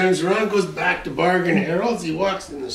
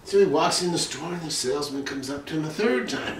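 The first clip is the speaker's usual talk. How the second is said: ordinary talk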